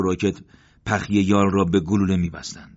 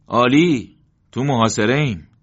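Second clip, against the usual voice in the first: loud, higher sarcastic cheer; ironic; 5 semitones higher, 6 dB louder than usual. A narrator's reading. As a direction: say great as a dark joke. Ironic reply after smiling.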